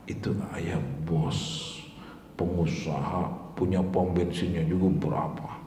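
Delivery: put-on a hushed elderly cleric's reply; quiet old cleric. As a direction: murmur it gently like a frail old kiai. Old cleric explains, voiced quietly.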